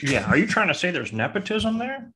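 high, swooping mocking impression; mock broadcaster reply. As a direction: play up fake innocent commentator banter. Acting Bob's reply to Kalena.

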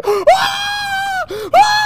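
very high long scream; acted scream. Man walking into bathroom and seeing him, hammed-up scream. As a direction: shriek twice at the sight.

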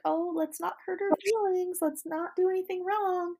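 high, sugary mock-gentle voice; a sweet high voice mocking how they tiptoed around her; mock coddling. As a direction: coo it like you're babying her. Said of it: "treating her like a marshmallow".

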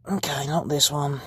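unvoiced whisper to herself. Real, not acted. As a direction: breathe it, no voice. Whispers after bad question.

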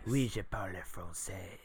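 real French phrase, soft and unhurried; Oui, je parle français said like a French speaker; soft fluent French; spoken French. Shown as a demonstration. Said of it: "Ooh, that was actual French".

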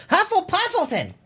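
high, goofy exclaimed mispronunciation; silly mock name. Reading out the news source as a joke, goofy and high. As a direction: ham up Huffington as Hufflepuffleton. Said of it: "mock-mangled newspaper name".

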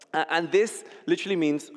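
ordinary talk, nothing marked

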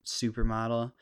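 normal talking voice

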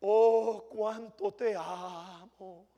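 drawn out with "te" leaned on, hushed and fake-sweet; mock devotion. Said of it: "cristianos que son tan fáciles".

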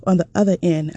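usual speaking voice

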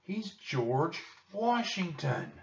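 high, slow, drawn-out reveal; excited exclamation. A narrator's reading. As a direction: stretch the name out in high-pitched amazement. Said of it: "he exclaimed".

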